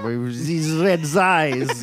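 slow, hammed-up French accent; comically overdone mock-French accent; bad French accent. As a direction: drag it out in a horrible fake French accent. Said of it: "give us a French accent".

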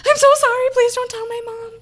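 high, pleading mock voice; pleading apology. The RA imagining Hank's apology, a high mock plea. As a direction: beg in a high voice.

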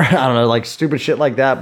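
ordinary talk

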